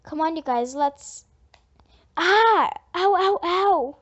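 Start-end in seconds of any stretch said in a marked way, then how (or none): 2.2-4.0 loud yelps of pain, high; stepped on, loud yelps of pain; pained cry. Acted. Narrator hurt in accident.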